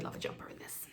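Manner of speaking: breathy whisper, a little quick; hushed wistful whisper; whispered aside. Real, not acted. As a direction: whisper it like a secret wish. Wistful aside about a jumper.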